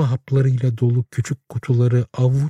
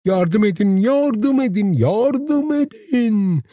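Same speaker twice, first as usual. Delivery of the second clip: from a high yell to a shrieking, drawn-out cry; each call climbs higher, the last one dragged out slowly; panicked calls for help, building higher; increasingly loud cries; 12 semitones higher, much slower than usual. A narrator's reading. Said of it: "giderek yüksek sesle bağırıyordu".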